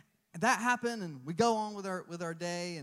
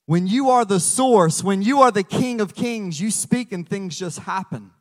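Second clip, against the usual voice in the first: full-force preaching; 10 dB louder than usual. Acted. Preacher declaring Jesus's authority, rousing loud preaching. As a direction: fire up the congregation.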